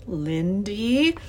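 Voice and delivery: hesitant call, rising at the end. A narrator's reading. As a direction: call out uncertainly. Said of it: "the professor hesitated".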